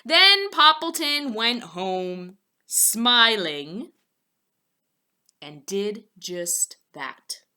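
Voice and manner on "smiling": ordinary delivery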